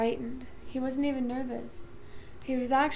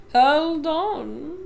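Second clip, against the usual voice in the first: cry; 2 semitones higher, 7 dB louder than usual. A narrator's reading. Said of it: "cried Mister Wonka".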